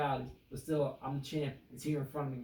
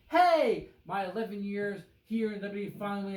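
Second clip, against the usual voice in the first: excited; 5 semitones higher, 5 dB louder than usual. Retold or imitated. Mocking Kofi's happy promo.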